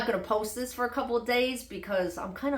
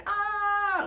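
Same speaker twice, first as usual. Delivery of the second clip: sudden high yelp of pain; mock scream; 12 semitones higher than usual. Telling of street pain, a short high mock scream. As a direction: let out a quick high cry. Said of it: "I start, like, screaming".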